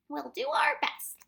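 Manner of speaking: high, slow, loud character voice; grave reply. A narrator's reading. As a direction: answer solemnly in the odd high voice. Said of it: "the Proc replied gravely".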